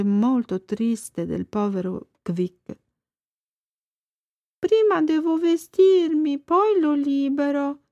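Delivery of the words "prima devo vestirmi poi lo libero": put-on a lightly raised boy's voice, defensive; boy Thomas. A narrator's reading. Thomas defending himself to the fish. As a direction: protest like a child. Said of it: "disse Thomas".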